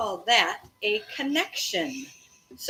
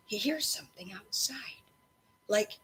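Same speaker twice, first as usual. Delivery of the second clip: whispered, secretive; hushed; 6 dB quieter than usual. A narrator's reading. Ben telling Noah about a sound, whispering. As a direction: lean in and whisper it. Ben explains dog's signal.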